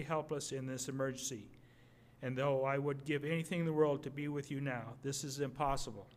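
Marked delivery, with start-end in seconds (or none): none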